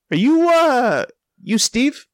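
high, drawn-out questioning retelling; hesitant question. Retold or imitated. Man asking a stranger if he is his friend, hesitant question. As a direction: pitch it up and stall on the "uh". Awkward check on mistaken stranger.

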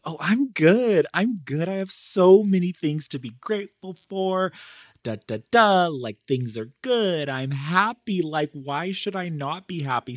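high, chirpy mock "I'm fine" voice; put-on happy front. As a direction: imitate your old front, insisting all is well. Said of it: "I put on the front".

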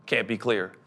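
ordinary talk

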